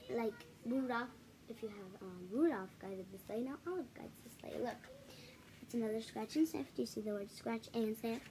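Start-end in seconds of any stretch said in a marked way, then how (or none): none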